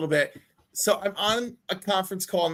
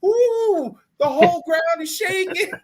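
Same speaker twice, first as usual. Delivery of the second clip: much higher, louder laughing mock fright; laughing mock panic; 9 semitones higher, 5 dB louder, slower than usual. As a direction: squeal it through a laugh. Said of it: "I'm over here like".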